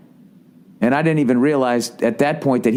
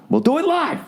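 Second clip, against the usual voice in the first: much higher, yelled "We'll do it live"; mock shouted catchphrase. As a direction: bark "We'll do it live" like a fired-up TV host. Shouted catchphrase after plain line.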